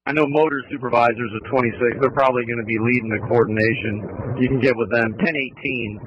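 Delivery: fast, tinny voice over a handset; radio voice. Real, not acted. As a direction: speak as if over a police radio.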